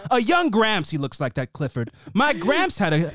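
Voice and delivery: normal talking voice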